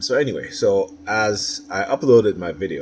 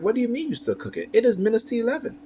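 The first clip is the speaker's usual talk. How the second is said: much higher, incredulous retort; exasperated disbelief. Retold or imitated. Husband retelling his reply to the wife, high incredulous outrage. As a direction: pitch it up in disbelief, almost squeaking. Arguing with wife about cooking late.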